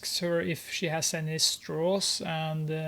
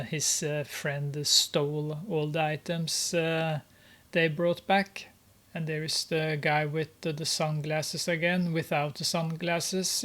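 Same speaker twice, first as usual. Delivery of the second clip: ordinary delivery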